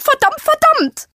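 shrieking, very high and loud; toddler tantrum. Acted. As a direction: stamp it out like a child. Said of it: "ihre weiche Stimme hob sich um eine Oktave".

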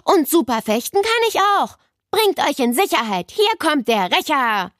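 high, swaggering schoolboy shout; boy's boastful yell. A narrator's reading. Basti warns everyone the avenger is coming, yelling. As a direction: announce yourself like a pint-sized avenger. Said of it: "brüllte Basti aus Neles Klasse".

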